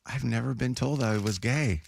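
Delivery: slow, mildly disappointed quote; retold disappointment. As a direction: imitate a let-down guy, drawn out. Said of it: "so, like, disappointed".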